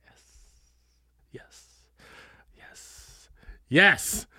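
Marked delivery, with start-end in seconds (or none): none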